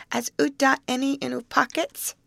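put-on high little-child lisping baby talk; Dodo. A narrator's reading. Small twin asking after candy in pockets, high baby talk. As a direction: ask in a tiny child's high voice. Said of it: "calmly announced Dodo".

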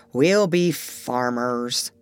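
slow, low, sad resignation; sadly. A narrator's reading. Pirate Ben Ali gives in to the doctor, sadly. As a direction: give in with a heavy heart. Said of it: "he said sadly".

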